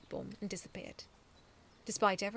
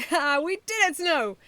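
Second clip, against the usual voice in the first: high, loud victory cheer; excited shout; 9 semitones higher, 13 dB louder, slower than usual. A narrator's reading. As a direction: whoop over the win. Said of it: "Medaby shouted".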